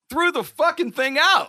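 outraged disbelief. Real, not acted. Telling hosts his wife tossed his prized prop, outraged. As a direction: hit it like you still can't believe it.

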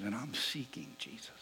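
soft whisper, almost breath; whispered confession. Retold or imitated. Seatmate confides his search in a whisper. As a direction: breathe it out like a secret.

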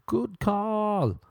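high, put-on voice of approval; hammed-up high-pitched acceptance; mock agreement. Acted acceptance of booze advice.

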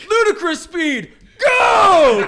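high, loud movie-quote yell; shouted quote. Retold or imitated. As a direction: bellow it like a captain's order.

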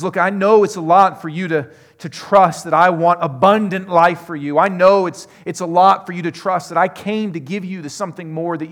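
loud, high pleading proclamation; forceful preaching. Acted. Pastor voicing Jesus reassuring the flock, loud and high. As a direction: press it home to the listeners.

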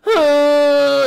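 loud, high hammed-up wail; long bawling cry, played for laughs; mock crying wail. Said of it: "and he's just crying".

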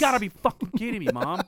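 put-on outraged teen protest. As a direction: blurt it out fast at mom, indignant. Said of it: "I'm like".

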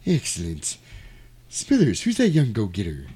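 scheming cartoon tycoon impression; creepy boss sizing up a newcomer; Mister Burns. Boss asking assistant about the young man, scheming. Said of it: "a really weird Mister Burns vibe".

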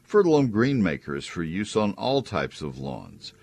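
upbeat radio ad read; chipper 1950s ad. Acted. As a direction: sell it like a cheerful announcer. Live read ad for sponsor.

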